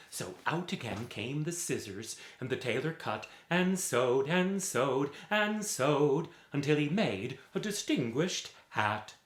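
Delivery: usual speaking voice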